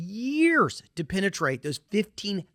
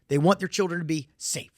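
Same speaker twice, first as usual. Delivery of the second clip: ordinary talk, nothing marked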